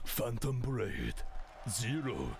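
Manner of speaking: very low, slow attack name; deep anime attack call. Pretend. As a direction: boom out the move name.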